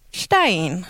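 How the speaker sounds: "stein" with pitch falling sharply. Shown as a demonstration. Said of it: "Difference between flats and falling tone".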